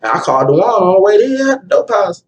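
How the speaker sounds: ordinary talk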